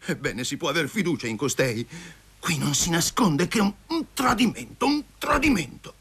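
high-pitched indignant tirade; outraged ranting. A narrator's reading. He rages at her betrayal.

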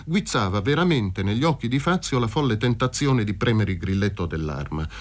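ordinary delivery

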